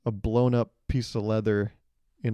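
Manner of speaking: usual speaking voice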